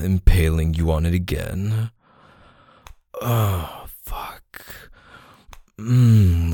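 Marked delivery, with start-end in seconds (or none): 4.0-4.9 a soft breathless whispered curse. Acted. Lover cursing softly under his breath. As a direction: let it slip out on a breath.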